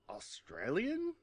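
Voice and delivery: high, drawn-out disbelieving question; incredulous. Retold or imitated. Podcaster reading out a note about the billionaire's origins, incredulous. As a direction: squeak it out as a baffled question.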